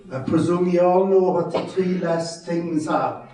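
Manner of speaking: ordinary talk, nothing marked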